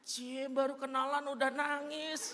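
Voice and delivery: high and laughing through the tease; teasing laugh. Retold or imitated. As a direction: tease them like a cheeky waiter. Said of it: "mas-mas ... lewat lagi nanya". (menggoda sambil tertawa, nada tinggi)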